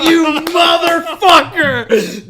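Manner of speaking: drawn-out, high-pitched yell at full volume; mock furious shout. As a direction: explode like an outraged dad, hammed up for laughs. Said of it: "Just so pissed".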